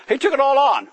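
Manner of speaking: loud high stress on took; emphatic. Shown as a demonstration. Preacher stressing Christ bore it all, loud emphatic. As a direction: punch TOOK hard and high.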